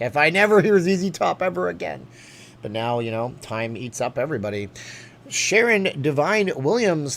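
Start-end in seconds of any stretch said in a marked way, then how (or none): 0.0-2.1 higher, louder fed-up groan; a hint of a sick-of-it road-trip kid; exasperated kid. Kid sick of one cassette on a long drive, mock exasperated. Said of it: "I was like". 5.3-7.2 slow, higher, louder host read; announcer voice. Acted. Streamer thanking a donor by name, announcer read.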